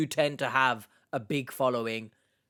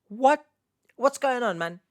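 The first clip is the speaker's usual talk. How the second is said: acted bafflement; 8 semitones higher than usual. Voices the simple question they should ask.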